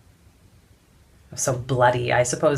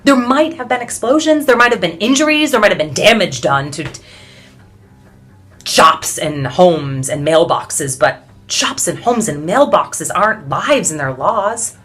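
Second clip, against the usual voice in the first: impassioned protest; 2 semitones higher, 8 dB louder than usual. Acted. Suffragist recounts protest, building in outrage.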